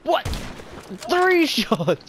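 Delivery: high-pitched shout of disbelief; shocked outburst. Real, not acted. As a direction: cry out in shrill disbelief. Disbelief at being one-shot.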